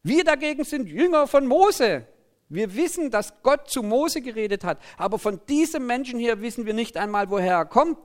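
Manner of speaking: high, loud indignant declaration; angry crowd. A narrator's reading. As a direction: proclaim it with acted outrage. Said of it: "Jetzt werden sie richtig wütend".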